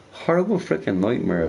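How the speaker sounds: ordinary delivery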